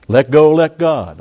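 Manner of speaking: slow scornful slogan; mock slogan. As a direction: sneer at the slogan slowly. Mocking pop slogan.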